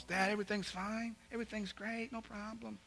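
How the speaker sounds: high, soft voice of a son brushing off worry; breezy son. Retold or imitated. Son calls dad saying everything's great, high breezy reassurance. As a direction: play the son lightly, high and quiet.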